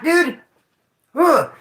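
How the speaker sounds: high strained pitch ending in a loud groan; anguished groan. Real, not acted. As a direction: groan in dread. Dread over missed call.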